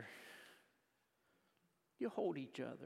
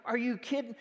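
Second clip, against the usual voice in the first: exasperated disbelief; 9 semitones higher, 9 dB louder than usual. Retold or imitated. Exasperated reply to Kim.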